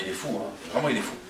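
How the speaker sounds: low, soft aside; muttered dismissal. Acted. Wife judging her husband mad, muttered dismissal. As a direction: mutter it to yourself in disbelief.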